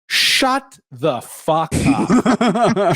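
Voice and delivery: hammed-up annoyed comeback; mock exasperated retort. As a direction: drag it out, fed up with the pedants. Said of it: "I'm like".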